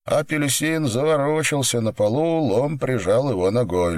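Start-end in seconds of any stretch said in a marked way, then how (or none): none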